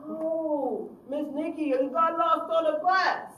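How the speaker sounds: put-on loud, slow insistent teen denial; lying youth. Youth insisting to his counselor that the check got lost, loud and slow. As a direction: stretch the lie out.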